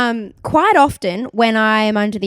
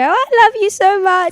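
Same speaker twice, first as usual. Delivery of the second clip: cooing at dog; 9 semitones higher, 3 dB louder than usual. Retold or imitated.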